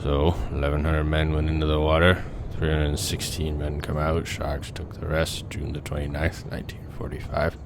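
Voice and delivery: a grim shark hunter remembering the Indianapolis; Robert Shaw as Quint. Acted. Reciting the Jaws monologue as a haunted old sailor. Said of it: "Tell me if I captured Robert Shaw".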